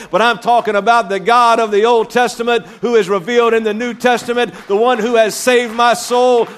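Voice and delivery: rising preacher's fervour, quickening; preaching fervour. Acted. Psalmist voiced, rising fervour.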